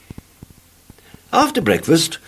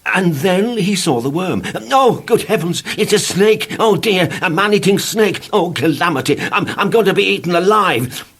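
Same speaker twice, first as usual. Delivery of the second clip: panicky cries; 9 semitones higher, 3 dB louder than usual. Acted. Mr Jelly fears a snake.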